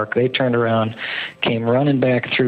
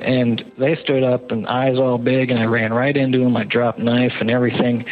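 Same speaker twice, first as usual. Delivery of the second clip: usual speaking voice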